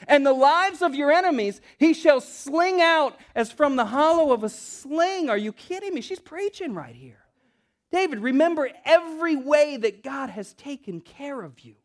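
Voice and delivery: high, loud, ringing proclamation; impassioned preaching. Acted. Preacher relays Abigail's plea to David, impassioned preaching. Preaches verse at full force.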